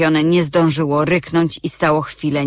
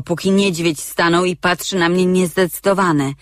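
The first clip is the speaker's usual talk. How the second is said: ordinary delivery, nothing marked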